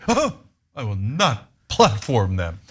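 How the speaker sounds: hammed-up gasp, then slow, pointed refusal; mocking prim outrage; mock indignation. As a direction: act appalled, spacing out each word. Mocking refusal to platform guests.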